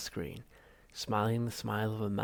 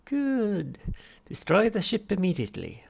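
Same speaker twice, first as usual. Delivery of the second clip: much higher, louder unctuous therapist; Halfrunt voice; 10 semitones higher, 8 dB louder than usual. Acted. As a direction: greet the patient with oily cheer. Psychiatrist voiced.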